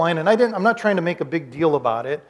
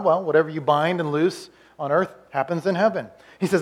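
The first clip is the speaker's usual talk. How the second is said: ordinary talk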